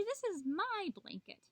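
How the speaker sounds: high-pitched, stubborn protest; protesting Coyote. A narrator's reading. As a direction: refuse in a high whine of complaint. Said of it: "cried Coyote".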